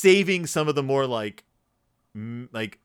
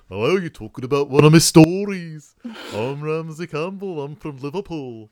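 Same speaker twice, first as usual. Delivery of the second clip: hammed-up Liverpudlian accent, louder at the start; playful Scouse impression of the author; mock Liverpool accent; 3 semitones higher, 7 dB louder than usual. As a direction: put on a jokey Liverpool accent. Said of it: "he's from Liverpool".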